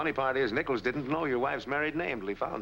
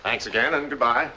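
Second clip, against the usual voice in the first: louder parting call from a distance; calling out goodbye; 3 semitones higher, 9 dB louder than usual. Acted. The detective calls goodbye to Mister Barkley, louder. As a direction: call the thanks back as you leave.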